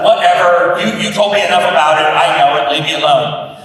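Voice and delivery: mock irritated wife brushing husband off; annoyed wife. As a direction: wave him away loudly, fed up. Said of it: "She's like".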